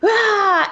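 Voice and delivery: put-on a sudden high, loud childish cry of frustration; frustrated yell. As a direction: yell it out like an overwhelmed little kid. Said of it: "me just being like".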